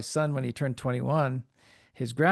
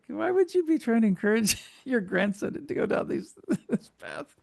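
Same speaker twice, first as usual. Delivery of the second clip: laughing; 8 semitones higher than usual. Real, not acted. Laughing at grandmother's push.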